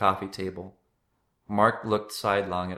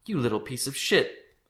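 higher-pitched outrage, not shouted; indignant, raised-pitch swearing; angry curse; 5 semitones higher than usual. A narrator's reading. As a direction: spit out an insult at a brother. Shoved brother curses from wreckage.

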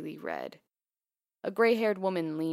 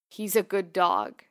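choked grief; 7 dB louder, slower than usual. A narrator's reading. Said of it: "the man coughed".

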